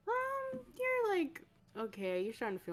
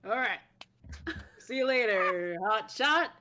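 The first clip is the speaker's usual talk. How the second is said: raised, jaunty send-off; loud cheeky farewell; 4 semitones higher, 10 dB louder than usual. Acted. Ogre ladies' escort saying goodbye to his pupil, loud and cheeky. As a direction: call it out as a parting tease.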